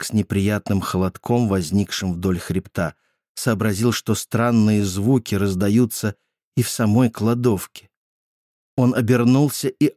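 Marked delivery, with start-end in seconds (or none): none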